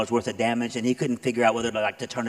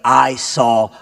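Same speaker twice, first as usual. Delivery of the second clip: "I saw God" slowed right down and said much louder; loud slow testimony; 9 dB louder, much slower than usual. Shown as a demonstration. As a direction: lean hard into "I saw God" like a life-changing confession. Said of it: "it's the same statement".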